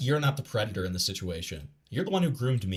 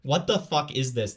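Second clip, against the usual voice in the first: ordinary talk, nothing marked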